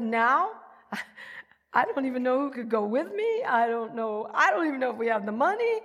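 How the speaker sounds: loud and high, flustered, with a quick laugh; startled protest. Retold or imitated. Answering the Lord who says go now, loud and astonished. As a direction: sputter back in disbelief. Startled reply to God's command.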